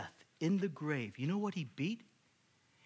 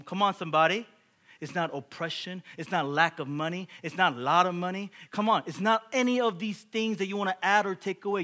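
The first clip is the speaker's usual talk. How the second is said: louder call to the congregation; rally the church hard; preaching at full force; 5 semitones higher, 10 dB louder than usual. Acted. Preaching climax.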